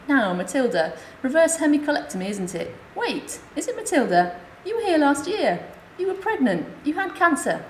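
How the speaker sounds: a friendly nurse, amazed to place her; lightly played nurse surprised to remember her; nurse's voice. Nurse recognises her on ward.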